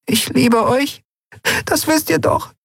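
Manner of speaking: a frail creature clinging to hope; fragile voice. Acted. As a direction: say it as if it might break. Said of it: "sagte mit zerbrechlicher Stimme".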